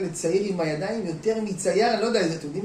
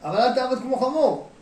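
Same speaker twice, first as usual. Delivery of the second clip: higher, louder urging as God; 6 semitones higher, 6 dB louder than usual. Acted. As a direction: press him to trust, voiced as God. God urges him to trust. (אלוהים מפציר, בקול רם וגבוה)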